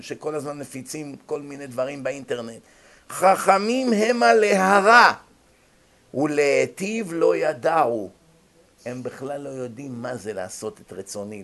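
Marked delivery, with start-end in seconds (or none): 3.1-8.1 slow, louder verse chant; solemn scripture reading; verse intoned. Acted. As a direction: stretch the verse out gravely. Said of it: "שימו לב איזה פסוק".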